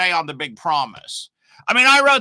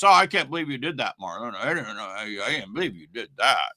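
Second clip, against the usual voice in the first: mock self-righteous scolding, lower and muttered along; put-on holier-than-thou disapproval; 6 semitones lower than usual. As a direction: imitate a sanctimonious critic, pitched down. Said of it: "So don't be all self-righteous".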